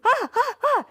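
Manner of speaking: high, loud mock panicked wails; hammed-up helpless ah-ah-ah; panicked cries. Pretend EMS worker losing it, mock panicked wails. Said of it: "the ones freaking out".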